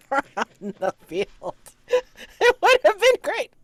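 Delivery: high, loud, breaking up with laughter; real laughter bursting through the words; laughing. Real, not acted. Player cracking up over the multi-tool gift, laughing.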